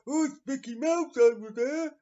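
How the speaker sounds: high, loud mocking tourist voice; excited chavvy tourist. Chavvy family spotting Mickey Mouse, loud mock excitement. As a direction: gawp loudly like a chavvy holidaymaker. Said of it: "these fucking chavvy families going over".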